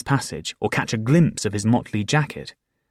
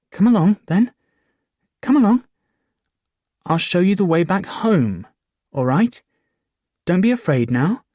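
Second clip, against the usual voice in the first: coaxing; 10 semitones higher, 5 dB louder than usual. Acted. Boy coaxing lost fool.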